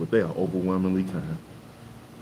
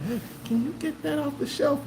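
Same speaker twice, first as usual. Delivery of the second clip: much higher asking voice; high little old lady request; imitating an older person; 3 dB quieter than usual. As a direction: ask sweetly for help reaching. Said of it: "when they say".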